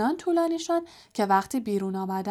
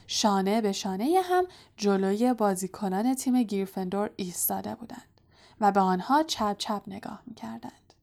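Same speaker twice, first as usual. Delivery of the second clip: usual speaking voice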